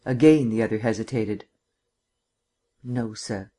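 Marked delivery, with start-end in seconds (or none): 2.8-3.5 put-on a soft, faint Southern accent no sir; barely audible. Captain answers the district attorney barely audible, soft. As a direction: barely voice the words, softer. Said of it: "His voice was barely audible".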